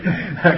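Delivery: a short real chuckle; short laugh. Real, not acted. Shared joke about Army.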